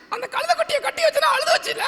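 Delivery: high-pitched puppet storyteller; டிங்கன். Pretend. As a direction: tell a tale in a tiny squeaky character voice. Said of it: "ஒரு கதை சொல்லணும்னு". (கதை சொல்லும் கீச்சுப் பொம்மைக் குரல்)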